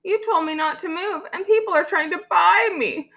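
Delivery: put-on high-pitched child complaint; high, whiny blame; upset little girl. A narrator's reading. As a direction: protest like an aggrieved kid.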